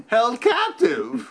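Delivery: laughing, high and slow. Retold or imitated. Patient laughing at his captive delusion. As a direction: laugh through the words.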